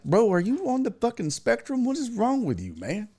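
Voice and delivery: high-pitched, incredulous challenge; retold outburst of disbelief; exasperated challenge. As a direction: demand an answer, pitched well up. Acted exasperated challenge to coworker.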